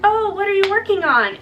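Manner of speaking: high, bright mock mom asking; imitating mom's sing-song question; lightly voiced mom. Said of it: "she was like".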